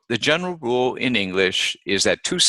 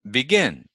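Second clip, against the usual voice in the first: closing fall after stressed GIN in BEGIN; second-syllable stress; 5 semitones higher than usual. Shown as a demonstration. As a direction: BEGIN as be-GIN, high peak on GIN then fall. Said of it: "the second syllable is stressed".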